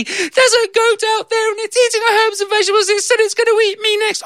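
put-on very high frantic child's voice; gabbled panic; panicked little girl. Girl crying to her mother about the goat, very high and frantic.

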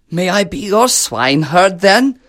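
a lightly voiced keen beggar; hopeful beggar. Beggar asks to be swineherd, hopeful.

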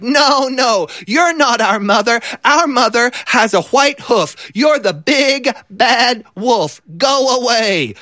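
put-on high, small goat-kid voice; a little goat standing up to the wolf; squeaky little kid shooing the wolf away; goat kid refusing. A narrator's reading.

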